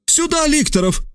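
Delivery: very high sharp call; exclaiming. A narrator's reading. Trasul summons lictors simultaneously, high urgent cry. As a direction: cry out for the guards at the same moment. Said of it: "воскликнул одновременно с ним Трасул".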